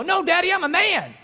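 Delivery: put-on high, loud little-boy defiance; imitating toddler son. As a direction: refuse like a defiant two-year-old. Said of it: "like that".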